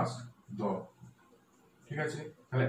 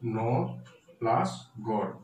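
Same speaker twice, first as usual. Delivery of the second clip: "কৃষ্ণ", "ন", "গড়" set apart by pauses; syllable by syllable; much slower than usual. Shown as a demonstration. Teacher breaking up the word for students, syllable by syllable. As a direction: dictate "Krishna ন plus গড়" piece by piece. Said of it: "উচ্চারণ করছো কিভাবে".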